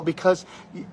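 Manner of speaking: ordinary talk, nothing marked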